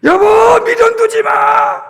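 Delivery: high, loud, slow sung-out cry; drawn-out plea. Acted. Husband crying out slowly to his wife. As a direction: stretch it out like a desperate call.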